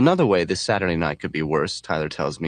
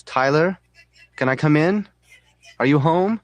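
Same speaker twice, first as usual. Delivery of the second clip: high, rising call into the house; yelling; 8 semitones higher, 5 dB louder than usual. A narrator's reading. Marla calling for Tyler from the front door, high yell. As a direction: call out questioningly, pitched up and louder. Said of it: "Marla yells".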